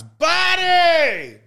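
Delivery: "Buddy!" yelled high and loud twice; loud repeated whistle call; repeated shout. Retold or imitated. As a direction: bark it out twice like a coach's call. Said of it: "he does it a couple times".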